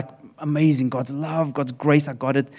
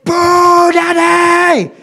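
put-on very high, loud toddler shout; toddler yelling; 13 semitones higher, 9 dB louder, much slower than usual. Father imitating his son screaming with joy at the show. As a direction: yell it like a thrilled little kid. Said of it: "He loses his mind every time".

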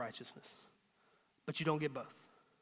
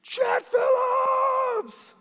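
much higher, very slow bragging shout; boastful yell; 10 dB louder, much slower than usual. Retold or imitated. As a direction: bellow it like a show-off stealing the glory. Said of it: "jumped in the back and yelled".

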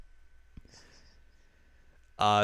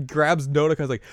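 ordinary delivery

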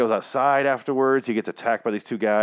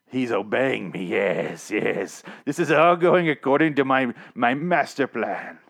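evil Negan; 3 semitones higher, 3 dB louder, slower than usual. Retold or imitated. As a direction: savor each word of the master plan. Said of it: "My evil Negan voice".

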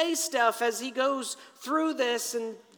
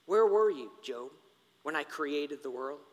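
God questioning; 7 semitones lower than usual. Acted. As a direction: question pointedly, letting the voice sink. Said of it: "So God begins".

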